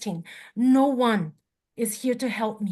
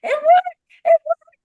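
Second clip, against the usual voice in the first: much higher and louder, bursting with joy; excited squeal; 8 dB louder than usual. Real, not acted. Car finally starts after two hours.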